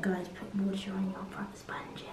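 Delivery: hushed whisper to the viewers. Real, not acted. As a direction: whisper it like a secret.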